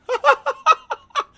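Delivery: loud high cackle; burst of laughter. Real, not acted. Streamer laughing at a chat message, big loud cackle.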